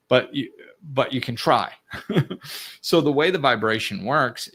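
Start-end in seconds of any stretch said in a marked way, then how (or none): 1.8-2.8 short breathy chuckle; a quick laugh at her own push. Real, not acted. Chuckling while nudging the listener to try.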